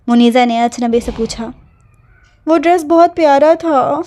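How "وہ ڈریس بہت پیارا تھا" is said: crying. A narrator's reading. Girl tells her cousin through tears about the lost dress, crying. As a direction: whimper it out like a sulking young girl. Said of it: "وہ پھر روتے ہوئے بولی".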